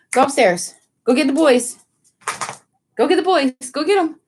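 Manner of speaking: high urging commands; pet talk to dog. Real, not acted. Owner urging her dog to get the boys, high. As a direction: cheer the dog on to go find them.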